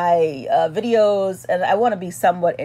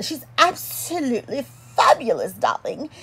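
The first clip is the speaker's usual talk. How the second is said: fabulous diva; 3 semitones higher, 4 dB quieter than usual. Pretend. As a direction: gush it like a grand diva. Hammed-up diva praise.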